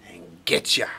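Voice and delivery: whispered scare; spooky whisper. Acted. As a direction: hiss it to spook the others. Scary tale told to friends.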